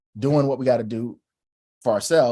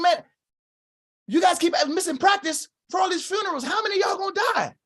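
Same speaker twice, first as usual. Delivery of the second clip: much higher shouted scolding; angry coach. Retold or imitated. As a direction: yell at the team, exasperated and fast at the end. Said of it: "he was mad".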